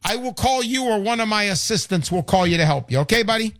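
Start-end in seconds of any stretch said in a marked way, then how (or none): none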